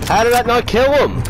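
high-pitched, disbelieving exclamation; incredulous outcry. Real, not acted. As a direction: cry out in disbelief at a failed hit. His hit failed to kill.

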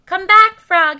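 loud, high plea; desperate shout. A narrator's reading. Toad spinning in the dark, shouting. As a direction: shout after a vanished friend. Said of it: "he shouted".

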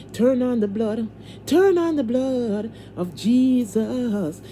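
slow, fervent prayer chant; preaching prayer. Real, not acted. Praying the blood of Jesus over herself, fervent and slow.

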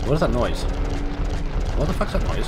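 startled. Real, not acted. Startled by game noise.

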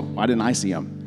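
ordinary talk, nothing marked

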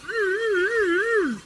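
high, soft muffled moans; wordless mm-mm through closed lips; muffled humming. Acted. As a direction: try to talk with mouth taped shut. Plastered mouth, trying to speak.

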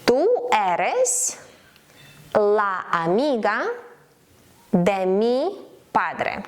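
slow Spanish in separate chunks, long pauses; slow dictation. Shown as a demonstration. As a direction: dictate it piece by piece to learners. Slow model sentence for learners.

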